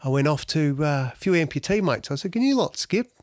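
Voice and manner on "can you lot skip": pitched way up in disbelief; incredulous question. Retold or imitated. Athlete asking amputee mates, high and hopeful.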